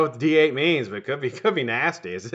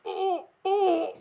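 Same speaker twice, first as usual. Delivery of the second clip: high, strained fake gasps of a heart attack; mock heart attack; 4 dB quieter than usual. Faking a heart attack to escape a neighbor, high strained gasps. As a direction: clutch your chest and fake it. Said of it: "fake a fucking heart attack".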